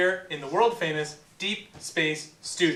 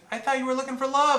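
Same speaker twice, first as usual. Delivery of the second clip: fast, louder, much higher protest; faster, louder, higher mock outrage; indignant; 10 semitones higher, 4 dB louder, faster than usual. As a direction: plead the date like a jilted host. Host presses his case.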